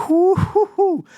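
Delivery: high, loud whooping hoots; excited whoops. Real, not acted. Reviewer hyped over his favourite chapter moment, loud high whoops. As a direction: whoop it up like your favourite moment just hit.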